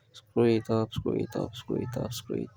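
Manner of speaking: distant, drifting off mic; muttered while working away; far from mic. Real, not acted.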